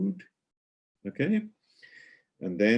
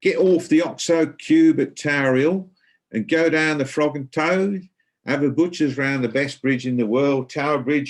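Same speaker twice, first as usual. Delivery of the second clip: higher and louder Cockney accent, like David Beckham; 8 semitones higher, 6 dB louder than usual. Shown as a demonstration. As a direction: read it out in a broad, cheerful Cockney accent. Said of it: "using a nice Cockney accent".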